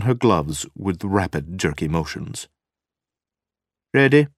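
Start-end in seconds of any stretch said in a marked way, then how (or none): 3.9-4.3 loud, high, curt question; clipped demand. A narrator's reading. Wife demanding the men get moving, clipped. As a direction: demand it in one sharp burst. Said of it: "she demanded in a clipped voice".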